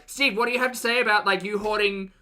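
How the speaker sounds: insistent doorstep-reporter voice; confronting TV reporter. Acted. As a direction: press for answers like an ambushing journalist. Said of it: "They're like".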